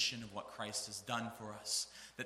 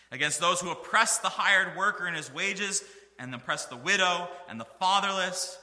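loud preaching; 6 semitones higher, 9 dB louder than usual. Acted. Malachi judgment passage, rising emphasis.

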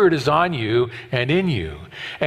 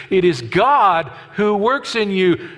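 "God" pressed hard and drawn out, slowly; stress on God; 4 semitones higher, slower than usual. Shown as a demonstration. Preacher showing the congregation where the stress falls, slowly. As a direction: lean into "God", slow the whole line. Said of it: "The word God there is emphatic".

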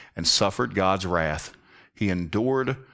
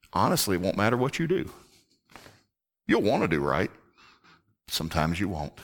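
normal talking voice

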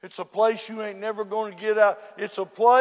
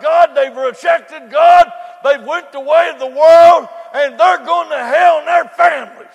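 preaching shout; 5 semitones higher, 11 dB louder than usual. Acted. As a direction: drive it home at full voice. Preacher at full force.

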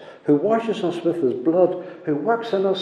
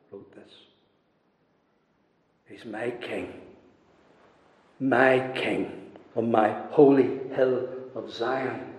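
normal talking voice

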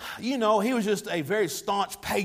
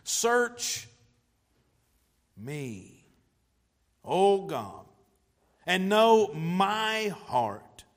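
prayerful reading; slower than usual. Acted. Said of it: "pray this prayer".

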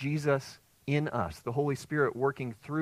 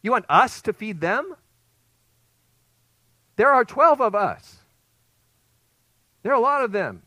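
incredulous protest; 9 semitones higher, 11 dB louder than usual. Retold or imitated. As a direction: protest in shock at an impossible order. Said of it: "What they're saying is".

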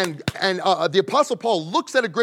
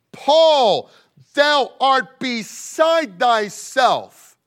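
high, booming and slow; loud voice; 6 semitones higher, 6 dB louder, much slower than usual. Acted. Festus the governor shouts down Paul in court, loud booming voice. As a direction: bellow it at Paul like a judge. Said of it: "Festus said with a loud voice".

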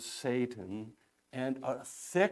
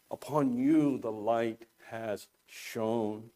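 "Upon you" softer and slower with you leaned on gently; quiet, lower, slow awe on "you"; hushed emphasis on you; 5 semitones lower, 5 dB quieter than usual. Shown as a demonstration. Preacher to his flock, a hushed slow stress on you. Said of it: "the emphasis on the word "you".

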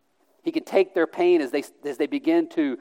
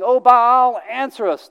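worshippers crying out to their god, raised and pleading; crying out to Baal; 8 semitones higher, 5 dB louder than usual. Retold or imitated. Prophets of Baal crying out to him, loud high plea. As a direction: cry it out high and loud like a desperate crowd. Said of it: "they're crying out from morning till noon".

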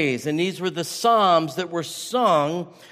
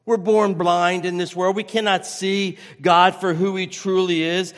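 ordinary talk

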